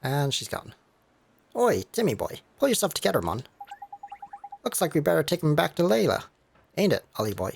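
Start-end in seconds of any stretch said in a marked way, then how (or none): 1.5-7.5 lightly voiced cocky Welsh sidekick; a hint of a Welsh lilt; cheeky Welsh fairy. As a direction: play the mouthy little fairy mate. Drippy calls to the cat.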